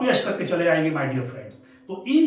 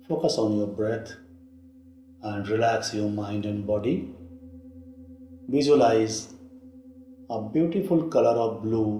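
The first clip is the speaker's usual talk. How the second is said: guided meditation; 9 semitones lower than usual. Real, not acted. Teacher guiding listeners through throat chakra meditation, low and unhurried. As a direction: lead them gently inward, low-pitched, with long pauses.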